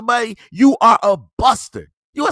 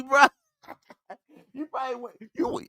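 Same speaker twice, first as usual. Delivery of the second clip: ordinary talk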